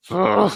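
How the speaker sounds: a high, exasperated mock ugh; half-played frustrated groan; retold disgusted groan. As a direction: let out a fed-up groan of disgust. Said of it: "I was boiling".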